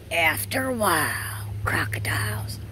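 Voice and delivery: breathy whispered pet voice; Tonka voice. Acted. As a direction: whisper it as the dog. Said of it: "And Tonka's gonna say".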